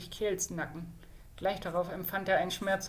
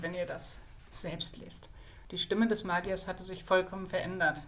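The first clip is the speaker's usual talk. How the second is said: plain reading voice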